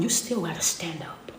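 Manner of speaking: hushed whisper, voice dropped right down. Acted. Preacher whispering to the congregation. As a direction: lean in and whisper the charge.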